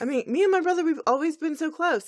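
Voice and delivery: high, loud gushing. Acted. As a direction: gush like a fangirl. Twilight gushing about brother.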